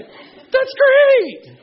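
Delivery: gushing praise. Retold or imitated. Parents cheering a toddler.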